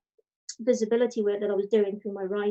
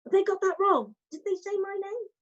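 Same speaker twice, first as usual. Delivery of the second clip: stunned disbelief; 10 semitones higher than usual. Retold or imitated. Retelling her shock at winning.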